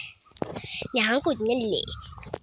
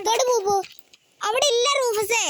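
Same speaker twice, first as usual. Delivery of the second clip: talking to the dog; 10 semitones higher, 3 dB louder than usual. Real, not acted. Vlogger showing Rufus the touch-me-not plant, in high pet talk.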